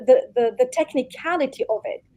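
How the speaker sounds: usual speaking voice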